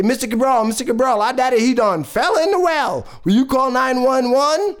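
put-on high, urgent kids' voices; excited children. Neighbour children beg the storyteller for help, excited and urgent. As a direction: plead in a high, hurried child voice. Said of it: "They say".

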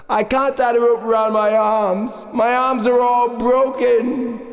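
high, plaintive wail; man down a well. Acted. Injured father answers the rescuers from the well, plaintive. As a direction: wail it upward, stretching the last words. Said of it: "The fella down the well says".

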